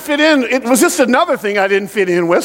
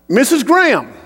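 ordinary talk, nothing marked

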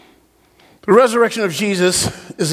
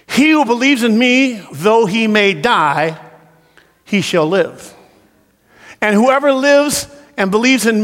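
slow, raised proclaiming voice; declaimed scripture; 7 semitones higher, slower than usual. Real, not acted. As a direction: read it out as a proclamation, phrase by phrase.

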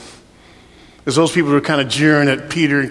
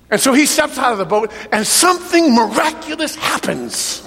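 preaching at full force; 6 semitones higher than usual. Real, not acted. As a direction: preach it with rising fire.

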